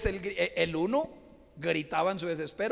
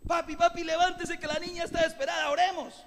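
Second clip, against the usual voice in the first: higher, hurried wife in a panic; wife's urgent plea; 9 semitones higher than usual. Retold or imitated. Wife waking husband at night for sick child, mock panic. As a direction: mimic a worried wife waking her husband. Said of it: "llorando, gritando de un dolor".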